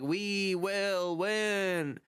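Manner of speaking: very slow, drawn-out mock shout with no emotion; Schumer's flat shout. Schumer rallying the crowd, slow, emotionless mock shout. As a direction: drag each word out, no feeling behind it. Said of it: "this is literally the tone of voice that he's shouting this in".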